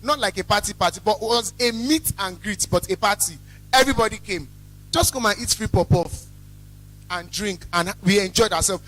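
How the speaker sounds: normal talking voice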